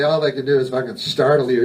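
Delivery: usual speaking voice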